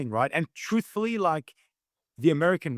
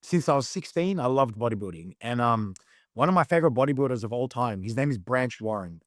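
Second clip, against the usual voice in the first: usual speaking voice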